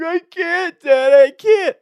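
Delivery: high, loud wailing plea; boy crying to his dad that he can't; crying kid. Retold or imitated. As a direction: wail it like a desperate small child.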